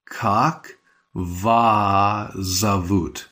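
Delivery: very slow, each word drawn out; slow careful model. Shown as a demonstration. Teacher modelling the question slowly for learners. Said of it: "So it's more like".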